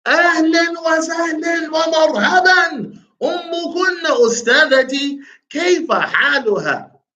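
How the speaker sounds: high-pitched, effusive greeting voice; dialogue questioner. Acted. Teacher acting out a textbook greeting for a student, high-pitched role voice. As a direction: play the questioner well above your own pitch.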